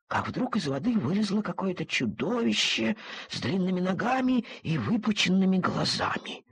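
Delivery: ordinary delivery, nothing marked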